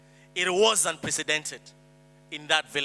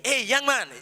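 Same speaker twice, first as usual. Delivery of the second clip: mild mock call-out, pitched higher; retold shout; 7 semitones higher, 3 dB louder than usual. Woman hails a young man in the street, retold shout. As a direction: imitate a woman hailing someone, lightly raised. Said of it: "a woman who shouted my name".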